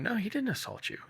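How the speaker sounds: high, soft insistent denial; protesting. Retold or imitated. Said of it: "I'm in the cinema going".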